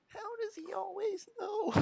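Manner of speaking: very high, soft squeaky impression; mock cartoon daughter in a thin falsetto; Meg. Streamer copying friends' ugly impression, high falsetto. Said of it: "impression of Meg for the first episode".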